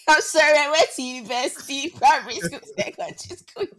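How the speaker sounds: high, loud, laughing through words; cracking up mid-sentence. Real, not acted. Laughing mimicry of friend.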